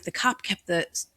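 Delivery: ordinary talk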